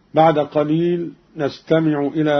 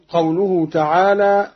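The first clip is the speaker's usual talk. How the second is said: normal talking voice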